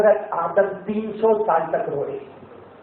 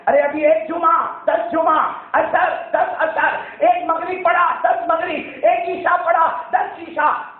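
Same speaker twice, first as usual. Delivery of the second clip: excited preaching; 10 semitones higher, slower than usual. Real, not acted. Preacher rousing the congregation, fervent counting. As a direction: count each reward with rising fervour.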